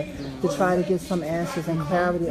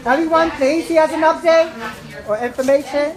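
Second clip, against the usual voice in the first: louder and higher to get attention; calling the crowd; 9 semitones higher, 6 dB louder than usual. Real, not acted. Organiser calling the protesters to hear an update, loud. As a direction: raise your voice over the crowd.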